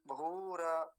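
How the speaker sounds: भूरा drawn out with extra final a; wrong full र. Shown as a demonstration. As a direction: mispronounce भूरा as bhū-ra. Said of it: "वो गलत".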